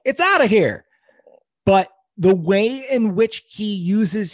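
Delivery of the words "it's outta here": imitating an announcer's call; announcer-style call of a homer; home run call. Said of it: "So his home run call is just basically like".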